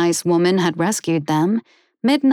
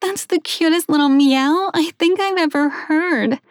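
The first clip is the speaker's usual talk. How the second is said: gushing woman; 9 semitones higher than usual. Acted. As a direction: melt over the fluffy little things. Woman gushing over meow.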